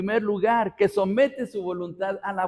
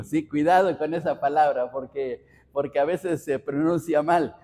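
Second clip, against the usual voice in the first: usual speaking voice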